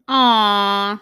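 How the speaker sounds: loud gushing aww. Real, not acted. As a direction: gush over something cute. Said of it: "That's so cool".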